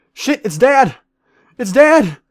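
high, loud alarmed cry; panicked yell. Real, not acted. Gamer spots a huge player named Dad and cries out in panic. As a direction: shout in real alarm.

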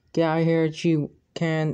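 plain reading voice

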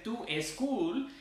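"to school" as "tu eschool", high, with a Spanish accent; heavy Spanish-accented "to eschool"; Sofía Vergara accent. Shown as a demonstration. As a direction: put an E before the S in "to school". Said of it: "empiezan a sonar como Sofía Vergara".

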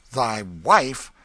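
loud, furious outburst; outraged. A narrator's reading. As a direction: explode at the word. Outraged at hearing wife.

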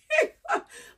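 a sudden high, loud laugh through tears; real laugh at crying on camera; high burst of laughter. Real, not acted. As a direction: let a teary laugh break out.

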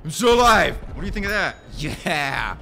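loud, high gloating yell; triumphant shouting. Real, not acted. Player still alive taunts the enemy, loud triumphant shouts. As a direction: crow it at the enemy. Gloating after surviving in game.

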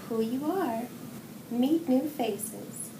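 usual speaking voice

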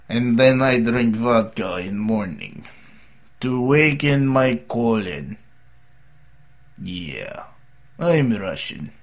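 slow, put-on Russian accent. Vlogger boasting about morning vodka, in a Russian accent. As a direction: play a Russian, drawn out slowly. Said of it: "How's my Russian accent".

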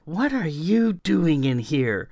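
high, sneering challenge to an intruder; ugly tone. A narrator's reading. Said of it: "in an ugly tone".

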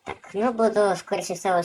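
ordinary talk

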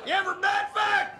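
lightly voiced country attendant; Southern accent. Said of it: "the guy from the gas station".

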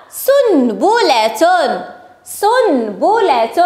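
very slow, syllable by syllable; slow sounding-out. Shown as a demonstration. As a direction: stretch the word so each sound stands out. Teacher stretches word for sound spotting.

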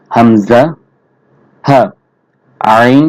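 ordinary talk, nothing marked

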